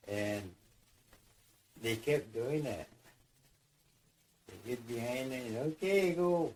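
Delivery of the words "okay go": high, louder call to push; mild call to start pushing; called-out signal. Retold or imitated. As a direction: shout the go to the crew. Crew signalling a log push.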